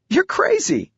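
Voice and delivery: put-on very high-pitched girl's exclamation; amazed daughter. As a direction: exclaim high at your crazy dad. Daughter laughing at dad's dare.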